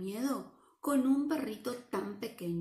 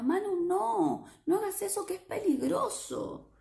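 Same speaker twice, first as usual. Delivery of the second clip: worried shout; 9 semitones higher, 3 dB louder than usual. Acted. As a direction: call out to stop a child in danger. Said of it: "Pero Miedo le gritaba".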